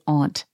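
"aunt" as ahnt, open vowel; own "aunt". Shown as a demonstration. Telling how she says it, "aunt" as ahnt. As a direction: say "aunt" to rhyme with "font". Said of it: "And I say".

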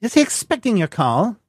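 high prim secretary voice; woman's voice. Acted. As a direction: answer like a switchboard secretary.

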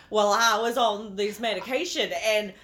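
hammed-up Chicago accent; mock Chicago patient voice. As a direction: retell it in a rough Chicago accent. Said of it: "full speaking like a, um, like, Chicago accent".

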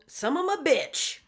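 mock-outraged slow curse; drawn-out curse. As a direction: savor it like a grudging compliment.

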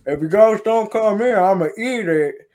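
high, loud Kentucky twang; deep country accent. Retold or imitated. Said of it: "he's got a deep country accent".

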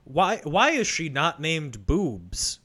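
normal talking voice

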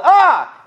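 high, loud "Ah!" yelled back; sudden high-pitched yell; answering yell. Retold or imitated. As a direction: fire back a startled scream. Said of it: "And then I yelled".

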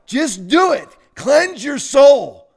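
loud, slow exhortation; preaching shout. Real, not acted. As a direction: cry it out to the crowd.

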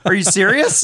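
hammed-up panic at a sudden demand; incredulous. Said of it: "it's like".

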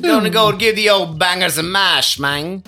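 a loud, high, hammed-up British accent; bad British accent. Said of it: "do your best British accent and say bangers and mash".

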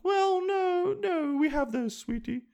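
put-on very high, fluty old-lady falsetto; the Queen. Queen gently refusing her grandchild, high mock-British falsetto. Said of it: "She'd be like".

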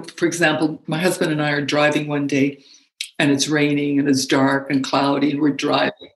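ordinary talk, nothing marked

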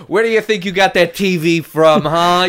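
a loud, high, cartoon Italian-American mamma; Italian-American accent. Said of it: "I can do this accent forever".

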